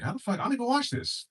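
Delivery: high, incredulous outburst; mock disbelief at the video; how did I end up here; baffled. Retold own baffled reaction.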